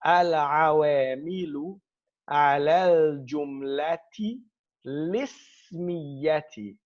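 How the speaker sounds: very slow demonstration, "lismiyati" joined with a kasra on the lam; careful classical pronunciation. Shown as a demonstration. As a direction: draw out each word, gliding into "lismiyati" without a hamza. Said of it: "We say, listen carefully".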